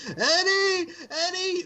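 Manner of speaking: very high-pitched mocking impression; mock whiny wail. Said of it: "she opens up that voice".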